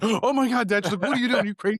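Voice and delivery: high, gasping daughter aghast at dad; imitated freak-out. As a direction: mimic a mortified kid's panic. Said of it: "They freak out".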